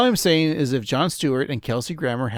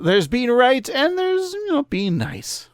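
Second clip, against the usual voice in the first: high quoted voice; 11 semitones higher than usual. Retold or imitated. Quoting Skinner in Marge voice.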